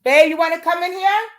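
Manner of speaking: loud and high, calling to the next room. Real, not acted. Woman calls her fiance into the room, loud and high.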